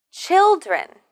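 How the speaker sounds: put-on "Children." with a high, falling first syllable; first syllable stressed. Shown as a demonstration. Teacher models the word children, first-syllable stress. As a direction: hit the start of "Children." hard. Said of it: "we need to stress the first syllable".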